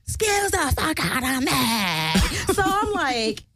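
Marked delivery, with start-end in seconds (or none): 0.1-2.5 slow, high, mocking put-on voice; mock an affronted man, stretched and raised; Skeletor impression. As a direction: drag it out in an exaggerated indignant voice. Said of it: "he's like".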